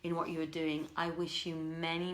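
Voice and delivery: ordinary delivery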